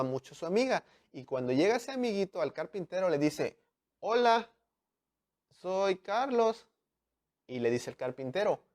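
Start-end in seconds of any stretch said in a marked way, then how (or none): 4.0-6.7 put-on child voice. Child introduces himself to carpenter.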